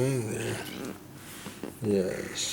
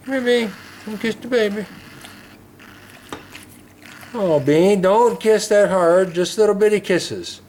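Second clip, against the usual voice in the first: coaxing pet talk; 9 semitones higher than usual. Acted. Coaxing dog to kiss bird.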